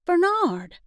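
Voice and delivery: happy greeting. Acted. Glad greeting to a friend.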